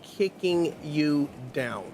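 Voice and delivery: very slow, drawn-out words; slow it right down. Shown as a demonstration. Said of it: "I had to slow that down".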